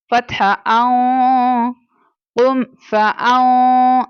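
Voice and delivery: कुम फा आउ slow, with a hidden nasal hum; slow Quranic spelling with a hidden nasal; nasal ikhfa. Shown as a demonstration. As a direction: spell it out slowly, hiding the n in the nose. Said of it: "आउ करके पढ़ा मैंने".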